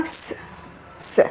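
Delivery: "स" as a plain "sa"; dental sibilant. Shown as a demonstration. Teacher demonstrates the tooth-root sound, dental "sa" demo on "स". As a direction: hiss "स" from the tooth roots. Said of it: "अब ये हुआ हमारा वर्तस्य दंतमूल".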